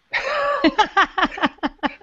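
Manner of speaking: a short high burst of laughter; sudden laugh. Real, not acted. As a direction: laugh out at the sting of the question. Laughing at the coach's question.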